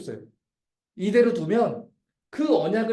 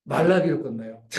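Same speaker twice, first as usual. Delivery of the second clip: normal talking voice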